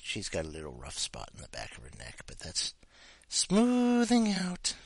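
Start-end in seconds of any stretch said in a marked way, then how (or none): 3.3-4.7 drawn-out high coo; slow high coo. Real, not acted. Owner petting the dog, slow high coo. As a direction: stretch it out sweetly to the pet. Cooing to a pet.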